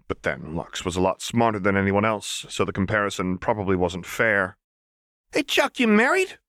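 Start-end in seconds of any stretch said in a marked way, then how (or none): 5.3-6.4 put-on high, sing-song playful girl; high chirpy menace; manic Jinx voice. A narrator's reading. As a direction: tease the hostage brightly. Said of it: "Jinx asked suddenly".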